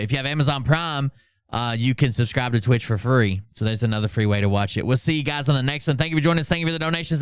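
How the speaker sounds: ordinary delivery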